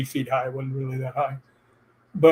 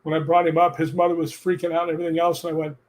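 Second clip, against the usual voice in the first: ordinary talk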